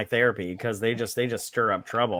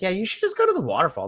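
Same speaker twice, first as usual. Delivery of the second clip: sarcastic; 6 semitones higher, faster than usual. Retold or imitated. Mocking advice to Vince Vaughn.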